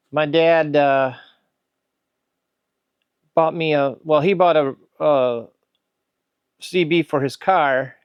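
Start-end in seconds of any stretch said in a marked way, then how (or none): none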